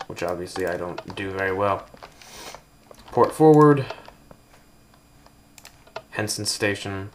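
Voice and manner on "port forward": loud, high, odd-toned announcement; over-the-top game voice actor; hammed-up announcer voice. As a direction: read it like a cheesy game voice. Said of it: "those sounded like the voice acting".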